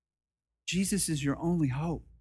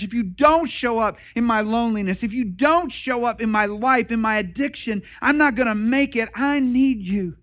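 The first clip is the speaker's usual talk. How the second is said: louder, pleading cry for help; desperate cry to Jesus; 5 semitones higher, 6 dB louder than usual. Retold or imitated. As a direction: beg like it's your last chance. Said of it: "cry out to Jesus and say".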